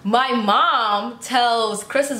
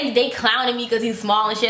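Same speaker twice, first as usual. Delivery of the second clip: usual speaking voice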